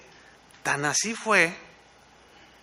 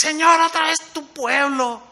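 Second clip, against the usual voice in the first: much higher, exasperated whine; fed-up complaint; 11 semitones higher, 5 dB louder than usual. Retold or imitated. Moses complaining to the Lord about his people, high and fed up.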